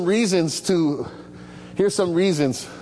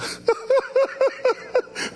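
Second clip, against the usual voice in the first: laugh. Real, not acted. Pastor laughs at own joke.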